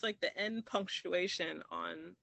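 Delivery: ordinary talk, nothing marked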